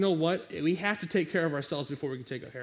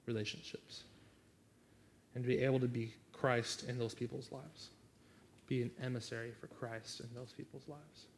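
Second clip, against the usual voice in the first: low, hushed pastoral appeal; soft altar call; 4 semitones lower, 6 dB quieter than usual. Acted. Pastor inviting the congregation to pray, soft and low. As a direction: ease them gently toward prayer.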